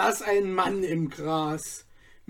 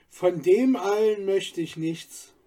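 usual speaking voice

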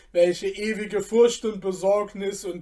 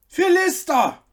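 high panting call of a stable master; breathless shout; 11 semitones higher, 6 dB louder than usual. A narrator's reading. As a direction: call out, gasping from running. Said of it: "rief ihm keuchend der Oberstallmeister zu".

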